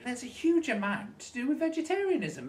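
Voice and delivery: ordinary talk, nothing marked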